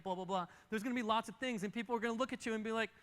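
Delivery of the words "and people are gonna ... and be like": ordinary talk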